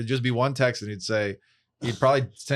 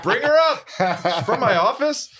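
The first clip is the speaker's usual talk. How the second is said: much higher and louder, eager receptionist voice; hammed-up cheery office greeting; mock office welcome; 12 semitones higher, 5 dB louder than usual. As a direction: gush like she's a coworker arriving, slowing at the end. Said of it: "I always expect to be like".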